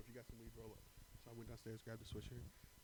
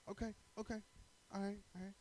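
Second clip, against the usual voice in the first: much higher, timid okays; placating okay; 9 semitones higher, faster than usual. Retold or imitated. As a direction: back off like a scared kid.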